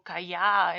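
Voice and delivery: "callá" said slowly with a y sound, ca-YA; wrong pronunciation. Shown as a demonstration. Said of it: "en vez de".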